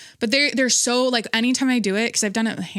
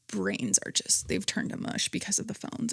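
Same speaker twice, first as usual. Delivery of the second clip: hushed aside; 9 dB quieter than usual. Real, not acted. Vlogger muttering about phones rotting brains, hushed. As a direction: drop to a whisper in disgust.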